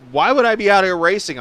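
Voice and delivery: a hammed-up shout of disbelief; mock yell. Retelling a driver yelling at him, mock yelling. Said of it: "yelled at me".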